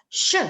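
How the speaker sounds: SHE loud with a sharp fall; fourth tone. Shown as a demonstration. Teacher modelling Mandarin tones for students, fourth tone. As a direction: drop she quickly from high to low. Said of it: "Tone keempat".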